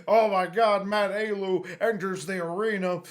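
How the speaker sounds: loud, hyped ringside call; wrestling commentator. Retold or imitated. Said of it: "talking like a wrestling announcer".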